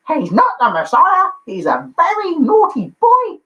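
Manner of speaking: high, loud mock mum scolding; Brian's mum voice. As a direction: squawk it like an outraged mother. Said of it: "I'll do it in the voice".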